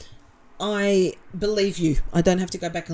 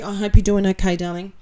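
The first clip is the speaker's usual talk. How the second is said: ordinary talk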